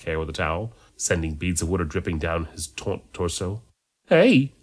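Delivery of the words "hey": high, loud smitten greeting; love-struck hello; swooning. A narrator's reading. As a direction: swoon over someone fanciable. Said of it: "swooned Alice".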